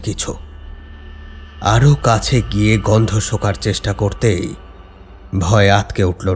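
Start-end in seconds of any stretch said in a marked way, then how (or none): none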